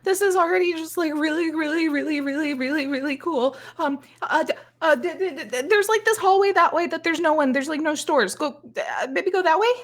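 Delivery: high, stammering, gushing fan voice; flustered fan. Acted. Fan points the idol to an empty hallway, flustered and high-pitched. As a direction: stumble over the words in a high starstruck voice.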